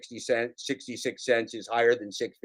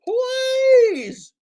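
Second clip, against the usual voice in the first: shrieking, crying plea, far higher and louder; John Billings impression; 8 dB louder, much slower than usual. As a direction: wail "please stop" like a desperate, sobbing man. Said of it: "my impression of John Billings".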